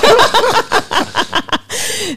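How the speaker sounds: long high-pitched burst of laughter. Real, not acted. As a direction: let out a real amused laugh. Laughs at own prediction.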